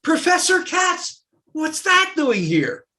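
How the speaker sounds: high, loud, slow puzzled question; mock student surprise. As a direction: act out a startled student. Students ask about the swastika.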